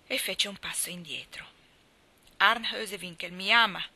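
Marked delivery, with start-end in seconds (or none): 2.4-3.8 loud, resonant declaration; loud, full-voiced announcement; full, ringing cry. A narrator's reading. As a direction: proclaim it in a ringing voice. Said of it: "gridò con voce piena e squillante".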